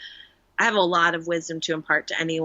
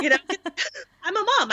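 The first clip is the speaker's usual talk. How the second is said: laughing; 12 semitones higher, much faster than usual. Real, not acted. Mom laughing.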